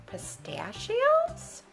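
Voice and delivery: slow, high rising question; puzzled. A narrator's reading. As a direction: stretch the word upward in bafflement. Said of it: "Pete and the gang were puzzled".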